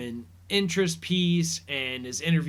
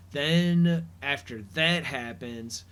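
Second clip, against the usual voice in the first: ordinary delivery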